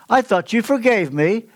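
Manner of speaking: wife's line voiced high and bright in a joke; mock wife.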